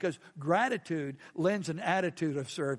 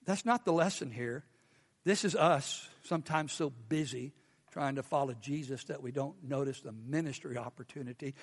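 ordinary talk

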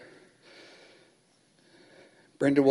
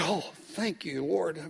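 soft murmured thanks to God, much softer; relieved prayer; 11 dB quieter, slower than usual. Retold or imitated.

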